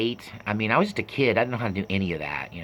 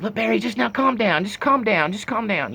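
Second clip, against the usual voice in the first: high-pitched imitation of women soothing; mock placating plea, repeated and fading; pleading women; 10 semitones higher than usual. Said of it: "all the women are making excuses".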